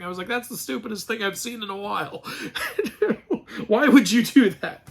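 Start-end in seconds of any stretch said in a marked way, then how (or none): 0.3-2.2 softly laughing through the words. Retold or imitated. Viewer recalling bursting out laughing at the film, softly laughing. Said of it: "I burst out laughing".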